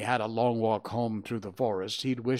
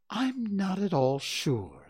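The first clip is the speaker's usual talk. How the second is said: slow, higher musing voice; thoughtful; 9 semitones higher, slower than usual. A narrator's reading. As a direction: ponder it aloud, drawing out the words. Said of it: "said Kanga in a thoughtful voice".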